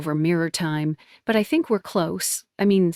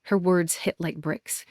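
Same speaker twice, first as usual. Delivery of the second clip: ordinary delivery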